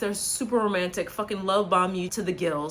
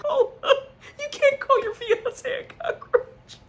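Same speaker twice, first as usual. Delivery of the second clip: high, slow, laughing protest; laughing at the fiance being called a cockroach; laughing disbelief; 4 dB louder, slower than usual. Real, not acted. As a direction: squeeze the words out through laughter.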